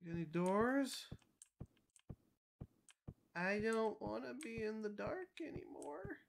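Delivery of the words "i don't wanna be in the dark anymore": whimpering plea. Real, not acted. Scared alone in the dark.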